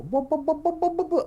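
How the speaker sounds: high sung bum bum of wheels rolling; truck wheels noise. Retold or imitated. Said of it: "the wheels go".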